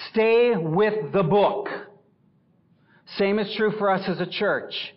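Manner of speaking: loud, high, driving pulpit voice; forceful preaching. Acted. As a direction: thunder the verse at the congregation.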